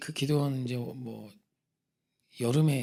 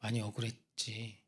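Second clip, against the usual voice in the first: gentle divine voice; 4 semitones lower, 9 dB quieter than usual. Retold or imitated. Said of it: "이렇게 말씀하시죠".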